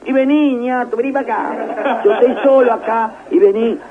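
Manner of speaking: high, loud teasing voice; mocking call reply. Retelling answering the president he thinks is a joker, high teasing voice. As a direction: brush off the caller as a prankster.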